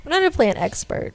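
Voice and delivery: ordinary delivery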